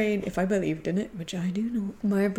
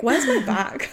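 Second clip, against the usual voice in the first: mildly raised acted exclamation; a touch louder, acting it out; saying it out loud; 5 dB louder than usual. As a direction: call it out to yourself. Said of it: "she says this out loud".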